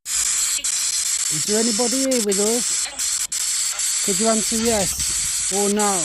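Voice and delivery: slow, loud, high call to spirits; calling out slowly. Acted. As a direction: draw each word out to the trees.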